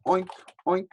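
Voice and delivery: high oinking; pig oink. Shown as a demonstration. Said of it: "A pig goes".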